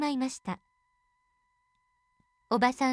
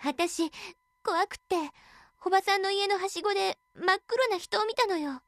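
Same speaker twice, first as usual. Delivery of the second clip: put-on frightened girl; 6 semitones higher than usual. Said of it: "娘は震えながら答えました".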